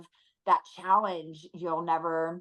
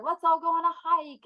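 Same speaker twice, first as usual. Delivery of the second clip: high, bright-voiced invitation to the family; lightly voiced cheerful rally; cheery suggestion; 11 semitones higher than usual. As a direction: pitch it up, inviting everyone along. Mom voicing playful family suggestions.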